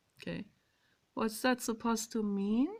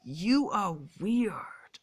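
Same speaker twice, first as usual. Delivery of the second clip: slow, stretched-out mock judgement, louder; imitated critics; 5 dB louder, much slower than usual. Voicing what other people could say, imitated. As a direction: drag out each word as a mock accuser. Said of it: "other people could say to you".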